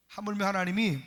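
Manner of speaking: ordinary talk, nothing marked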